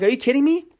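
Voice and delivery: high, loud exasperated shout; a gamer's outraged shout at a missed heavy; outraged disbelief. Retold or imitated. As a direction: yell it at the screen in disbelief. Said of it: "I'm like".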